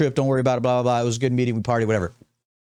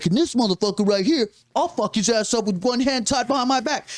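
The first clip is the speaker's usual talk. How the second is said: higher, tough Jersey guy threat; Jersey accent; 5 semitones higher than usual. Retold or imitated. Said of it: "he kinda had a Jersey accent too".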